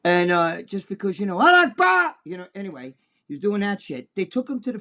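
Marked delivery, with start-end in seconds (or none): 1.4-2.2 high, loud shouted cry; mock battle cry. Mocking the attacker he retells, a high loud shout. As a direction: yell it like a fanatic's war cry.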